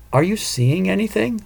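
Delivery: a touch of impatience, lifted pitch; mildly impatient question; lightly voiced impatient ghost. A narrator's reading. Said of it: "Monica asked impatiently".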